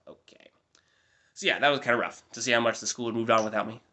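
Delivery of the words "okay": slow, soft, breathy wow okay under the breath; a muttered, resigned aside after being ignored; deflated deadpan. Retold or imitated. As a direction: let the air out, stung and unimpressed. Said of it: "And I was like".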